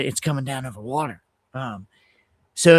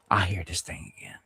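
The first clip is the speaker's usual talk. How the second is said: hushed; 8 semitones lower, 7 dB quieter than usual. Real, not acted. Hushed dread as plane returns.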